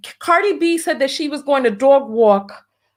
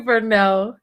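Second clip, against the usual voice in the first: normal talking voice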